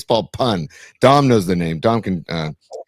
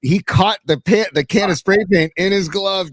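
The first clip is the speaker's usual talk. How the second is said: usual speaking voice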